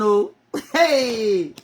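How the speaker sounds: ordinary talk, nothing marked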